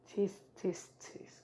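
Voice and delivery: breathy whispered tut-tut; mocking whisper. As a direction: whisper the scolding to taunt him. Naruto mocks Sasuke.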